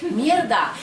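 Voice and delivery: sharp exclaimed curse; half-joking swear; mock curse. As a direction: blurt it out, caught out. Sudden realisation of being late.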